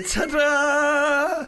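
high, loud showman flourish; hammed-up stage entrance; mock ta-da. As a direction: announce it like a magician.

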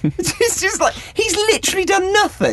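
high squeaky mock dad; exasperated dad voice. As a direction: whine it high like a fed-up dad. Continuing the dads' rant.

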